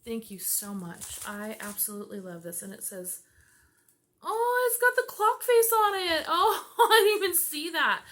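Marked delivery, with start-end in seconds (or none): 4.2-8.0 loud, high, near crying with happiness; tearful joy. Real, not acted. Overwhelmed by a thoughtful gift from a friend, laughing and teary. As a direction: gush through happy tears. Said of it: "I'm not gonna cry".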